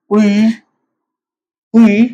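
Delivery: teacher's example 'उई', drilled for the class to repeat; Swedish word. Shown as a demonstration. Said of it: "इसकी जो pronunciation है".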